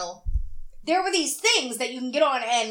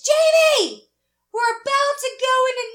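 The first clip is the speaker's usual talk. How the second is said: much higher and louder, sung out from afar; shouted sing-song call; 11 semitones higher, 7 dB louder than usual. Retold or imitated. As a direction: call across the trail, singing the words. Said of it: "she screams to me from about five hundred feet away".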